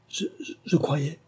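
nervous stammer with repeated "je". Acted. Bertie stammering to the captain, nervous. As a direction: trip over the first word, unsure. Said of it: "balbutia Bertie".

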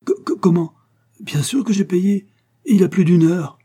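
high, loud flustered protest; stunned stammer. A narrator's reading. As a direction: stumble into a panicky, high-pitched protest. Stunned director stammers he paid. (bégaiement affolé, voix aiguë et forte)

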